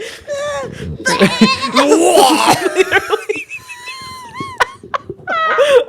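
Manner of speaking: long, very high squealing laugh; shrieking laughter. Real, not acted. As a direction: lose it completely in screeching laughter. Cracking up, acting out the vomiting.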